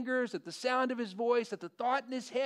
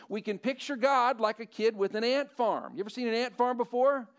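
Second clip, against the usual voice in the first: ordinary talk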